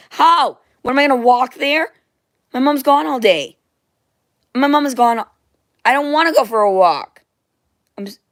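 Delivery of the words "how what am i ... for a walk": higher, louder whiny protest; indignant shout. Real, not acted. Told to go work out, a loud higher protest. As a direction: refuse loudly, exasperated.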